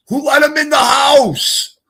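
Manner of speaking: high, loud, indignant cry; shocked shout. Retold or imitated. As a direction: yell it as if someone betrayed you. Retelling his own shouted reaction.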